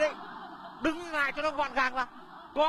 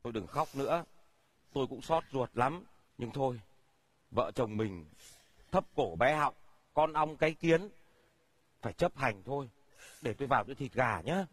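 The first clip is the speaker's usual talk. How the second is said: resigned consoling; 11 semitones lower than usual. Acted. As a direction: console her, defeated. Husband consoles crying wife.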